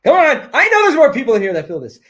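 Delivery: high, fast, forceful push; rally them to speak up; loud urging. Real, not acted.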